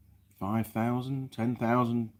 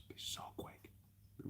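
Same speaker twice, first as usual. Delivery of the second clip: whisper; 24 dB quieter than usual. Real, not acted. Dreaming of a plasma cutter, whispered to himself. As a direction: drop into a breathless whisper.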